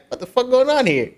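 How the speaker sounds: high-pitched, incredulous question; retold bewilderment. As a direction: replay your own baffled reaction. Acted bewildered reaction in story.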